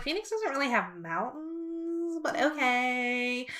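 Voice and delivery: slow, soft puzzled mutter; a hushed, baffled slow aside; confused aside. Retold or imitated. Telling a friend about the star's odd remark, confused and quiet. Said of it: "I was so confused".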